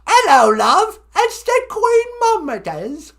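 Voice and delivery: very high, loud Monty Python granny; shrieking pantomime dame as the Queen; Queen impression. Shown as a demonstration. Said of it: "Queen of England".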